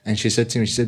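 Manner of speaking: usual speaking voice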